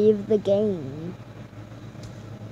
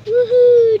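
high, loud whoop; excited whoop; 11 semitones higher, 9 dB louder than usual. Real, not acted. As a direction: cheer as you bounce. Bouncing on trampoline again.

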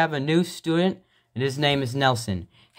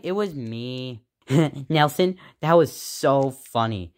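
usual speaking voice

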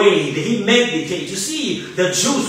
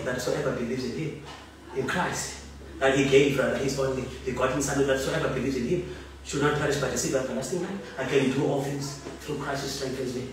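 fast, very soft, low murmur; quick hushed recitation; murmured scripture; 8 semitones lower, 10 dB quieter, much faster than usual. Shown as a demonstration. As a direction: rattle the verse off under your breath. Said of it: "When you meditate".

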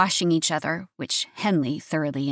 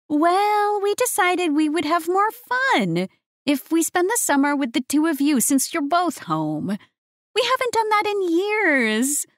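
high, chirpy motherly voice; 10 semitones higher than usual. A narrator's reading. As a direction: cheerfully explain to the kids. Mother explaining early return.

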